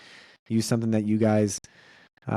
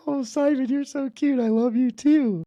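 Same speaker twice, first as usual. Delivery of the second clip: much higher, gushing mother's voice, slowing at the end; gushing mom; 3 dB louder than usual. Retold or imitated. Wife thanks their son for a Lego flower, higher, gushing mock coo. Voicing mom touched by gift.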